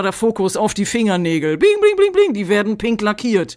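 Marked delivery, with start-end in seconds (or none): 1.6-2.3 sparkly bling sounds. Pretend. Sparkle sound effect imitation.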